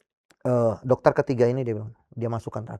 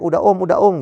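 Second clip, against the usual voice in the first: higher, louder mock plea; mock yelling; 7 semitones higher, 7 dB louder than usual. Overdrawn credit card begging its owner, mock yell. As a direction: play a credit card crying out for mercy. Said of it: "kartu kredit udah teriak-teriak".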